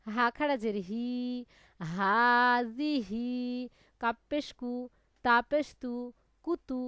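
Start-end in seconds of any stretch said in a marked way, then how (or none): 1.8-3.3 হাজিহি read very slowly, the long "হা" stretched; drawn-out vowel. Shown as a demonstration. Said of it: "এক আলিফ টেনে পড়তে হয়".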